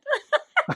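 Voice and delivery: real squealing giggle; high laugh. Real, not acted. Woman laughing at her smuggling story, high giggle. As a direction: burst out in high, loud laughter.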